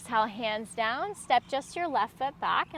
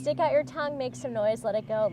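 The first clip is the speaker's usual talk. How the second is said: ordinary delivery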